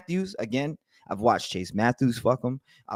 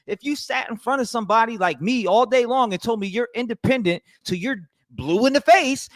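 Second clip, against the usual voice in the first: ordinary delivery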